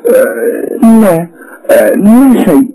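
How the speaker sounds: tearful denial. A narrator's reading. Wife denies anything happened, close to tears. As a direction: hold back tears.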